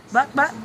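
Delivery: high clucking imitation; chicken cluck. Owner clucking at the chickens. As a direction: cluck like a hen.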